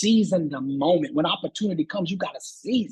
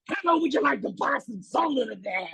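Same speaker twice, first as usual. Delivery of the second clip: fired-up salesman; 5 semitones higher than usual. Retold or imitated. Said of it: "Like he's fired up".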